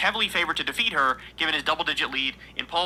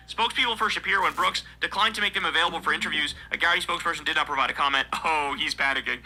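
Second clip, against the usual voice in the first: ordinary delivery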